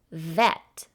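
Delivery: normal talking voice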